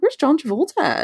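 high-pitched puzzled question; retold bafflement. Host retelling wondering where the actor is, high-pitched bafflement. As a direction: ask it high, imitating your baffled self.

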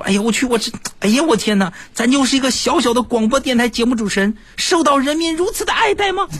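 high, giddy astonishment at being adored; thrilled inner voice. Retold or imitated. Radio host amazed a fan grabs his hand, high giddy thrill. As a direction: gush with disbelieving delight. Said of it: "当时我很兴奋".

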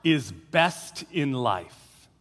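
low, slow, drawn-out tyrant question; lightly voiced warlord asking at a feast; a hint of a warlord. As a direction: savour each word like a brooding conqueror king. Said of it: "He says".

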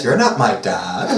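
Paris. Acted. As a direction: drop low for a petulant comeback. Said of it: "Paris added".

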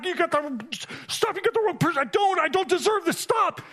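overwhelmed pleading in a high voice; overwhelmed protest. Retold or imitated. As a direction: push back against a love you feel you don't deserve. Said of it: "I want to say".

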